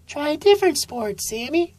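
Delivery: high, gentle mom voice; high-pitched mom encouraging her son; mother sloth voice. A narrator's reading.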